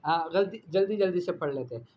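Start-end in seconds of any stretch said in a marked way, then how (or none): none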